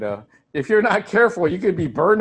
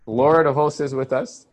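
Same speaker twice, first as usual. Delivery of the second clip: normal talking voice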